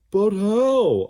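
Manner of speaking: very high, loud blob question; worried blob. A narrator's reading. Slime panicking about escape, high and loud. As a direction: squeak it out. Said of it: "asked Slime".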